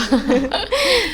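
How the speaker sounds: real high-pitched giggle. Real, not acted. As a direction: laugh lightly after the greeting. Laughing after greeting the audience. (tertawa geli)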